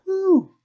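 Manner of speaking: very high, loud whoop; crowd whoop. Acted. Audience member cheering the host, high whoop. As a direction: whoop like a whistling fan in the crowd. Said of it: "You hear a few whistles".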